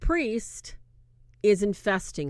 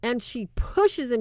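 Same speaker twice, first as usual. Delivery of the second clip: ordinary talk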